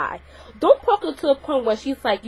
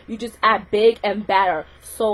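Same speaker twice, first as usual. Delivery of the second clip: normal talking voice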